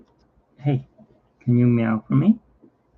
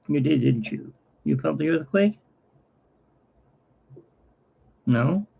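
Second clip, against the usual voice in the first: talking to the cat; 5 semitones higher, 3 dB quieter than usual. Real, not acted. Asking the cat sweetly.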